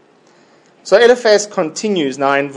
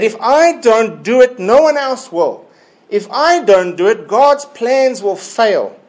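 higher-pitched, emphatic mock self-importance; self-important attitude; 7 semitones higher than usual. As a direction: act out someone convinced it all hinges on them. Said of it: "It's that kind of attitude".

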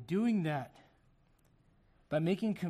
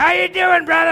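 loud, high hollered hello; yelled greeting; 13 semitones higher, 21 dB louder than usual. Retold or imitated. Pastor imitating Brother Spurgeon yelling hello at him. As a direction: holler it like an overeager church greeter. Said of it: "anybody remember Brother Spurgeon".

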